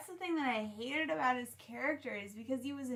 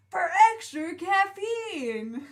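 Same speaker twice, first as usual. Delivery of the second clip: ad announcer; 7 semitones higher, 6 dB louder, slower than usual. Retold or imitated. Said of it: "they're like".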